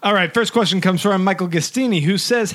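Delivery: ordinary delivery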